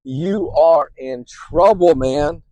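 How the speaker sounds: loud and panicky, driving the alarm; anxiety yelling. Retold or imitated. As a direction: yell it like a voice grabbing your collar. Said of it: "screaming at you".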